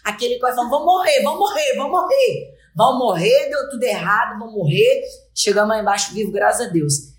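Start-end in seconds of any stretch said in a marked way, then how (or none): 0.7-5.0 high, chanting prophet of doom; mock doom-sayer. Guest on the dark stairs chanting that everyone will die. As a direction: chant it higher, like a doomsayer.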